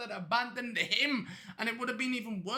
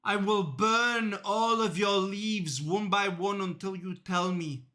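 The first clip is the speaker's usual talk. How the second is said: menacing threat; 3 semitones lower than usual. Acted. Cop threatens killer with lighter.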